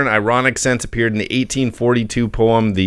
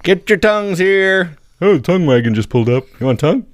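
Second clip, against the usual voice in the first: a playful mock street vendor shouting higher; jokey old-time tongue-wagon peddler calling out; 9 semitones higher than usual.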